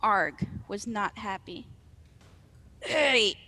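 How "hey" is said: rough troll calling out in protest; troll shout. Acted. Arg claims the bridge from his rival, troll shout. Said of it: "he shouted".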